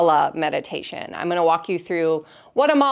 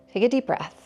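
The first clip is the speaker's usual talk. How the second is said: guided meditation. Real, not acted. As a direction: ease listeners into noticing each sensation, leaving space between phrases.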